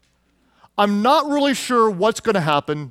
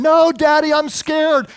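imitating a frightened little boy, much higher and a bit louder; scared child's cry; 8 semitones higher, 3 dB louder, slower than usual. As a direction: squeal it like a scared kid on a four-wheeler. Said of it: "he was screaming the whole time".

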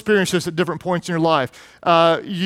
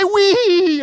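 put-on very high, louder squeal of delight like a little boy; gleeful wee; 11 semitones higher, 4 dB louder than usual. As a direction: shriek it like a kid loving the ride. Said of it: "he'd say".

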